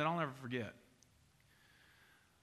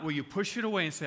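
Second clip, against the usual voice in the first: ordinary talk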